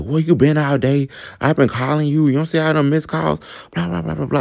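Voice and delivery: high-pitched acted-out scolding; imitating mad boyfriend. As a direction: rattle off a mock jealous telling-off. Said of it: "He is really mad".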